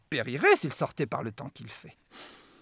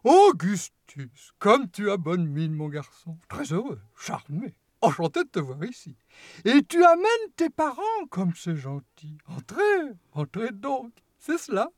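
a showman's welcome, sweet and slightly mocking; gleeful chocolatier greeting his guests; eccentric host; 3 semitones higher than usual. Wonka greets each child.

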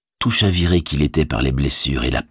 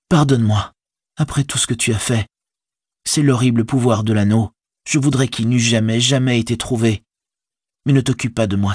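higher, pleading voice easing into resignation; remorseful Frodo; 6 semitones higher than usual. A narrator's reading. Hobbit apologising to his servant, higher and remorseful. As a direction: plead forgiveness, then settle down resolute.

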